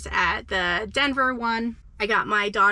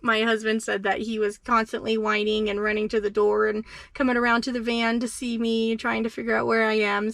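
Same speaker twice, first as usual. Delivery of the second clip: ordinary talk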